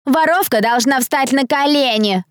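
high, slow, gloating demand; taunting chime-in. A narrator's reading. Bully's friend to accused thief, taunting demand. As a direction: pile on with drawn-out scorn. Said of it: "подхватила её подружка".

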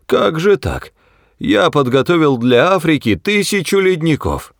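high, dismayed objection; aghast, high-pitched complaint; stunned protest. A narrator's reading. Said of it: "воскликнул ошеломлённый Сларти Бартфаст".

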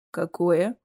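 plain reading voice

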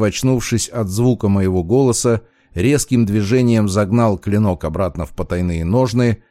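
ordinary delivery, nothing marked

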